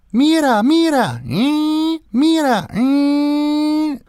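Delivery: put-on high, loud, whiny kid calls ending in a shouted eh; imitating toddler. Mocking the neighbor's toddler, high loud whiny shout.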